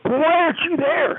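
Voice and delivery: high-pitched, scolding outburst; retold yell. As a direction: yell it like an impatient boss. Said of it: "He yelled, real".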